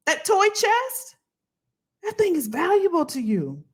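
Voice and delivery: preaching it. Real, not acted.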